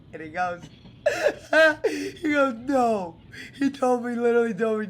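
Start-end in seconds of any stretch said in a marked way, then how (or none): 0.7-2.2 loud laugh mid-story; burst of laughter. Real, not acted. Laughing while retelling. 2.2-4.9 laughing. Real, not acted. Retelling while still laughing.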